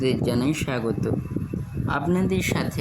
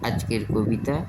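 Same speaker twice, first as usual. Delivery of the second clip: usual speaking voice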